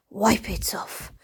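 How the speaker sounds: whispered to the wipe. Real, not acted. Cleaning a stubborn stain, slow playful whisper. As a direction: whisper it slowly, egging the wipe on.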